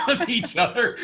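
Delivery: laughing through the words. Real, not acted. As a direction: let a laugh break into the line. Laughs while quoting own reading.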